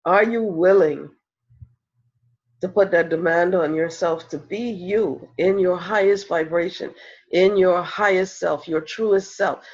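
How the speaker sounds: normal talking voice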